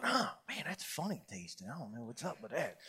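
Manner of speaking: low, muttered thought to himself; a low private grumble about the odd coffee; quiet inner thought. Retold or imitated. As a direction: mumble it under your breath, puzzled.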